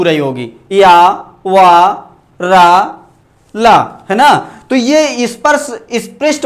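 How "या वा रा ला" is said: या, वा, रा, ला drawn out very slowly, one by one; slow sounding out. Shown as a demonstration. Teacher to class, showing tongue touch, slow demo of या वा रा ला. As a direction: stretch each sound of या वा रा ला with the tongue lightly touching. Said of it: "देखिए, आपके दांत में जीवा थोड़ा थोड़ा छू".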